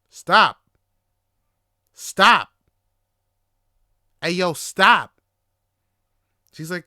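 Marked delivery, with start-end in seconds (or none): 0.1-5.1 very loud, separate bursts of "stop"; acted-out exasperated yelling, punched hard; upset shout. Telling his date to stop offering weed, upset shouting. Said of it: "getting more and more upset".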